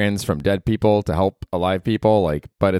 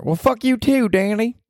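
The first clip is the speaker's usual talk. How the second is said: Ruth from Ozark. Acted. Podcaster voicing Ruth, cursing at him, brash and high. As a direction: snap it like a foul-mouthed hillbilly girl. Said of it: "Marty's not even here".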